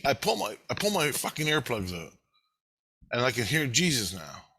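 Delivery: normal talking voice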